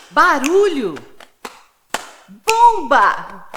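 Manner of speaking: energetic word-association drill, called out; lively game calls. Shown as a demonstration. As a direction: shout out the chain of words like a game.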